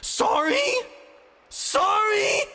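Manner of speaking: very high, loud incredulous howl; outraged screamed sorry. Acted. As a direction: throw his word back at him screaming. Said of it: "the mechanist howled".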